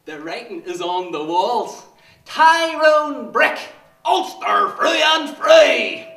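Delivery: fanatical shout. Acted. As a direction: roar it like a rally slogan. Shouted slogan.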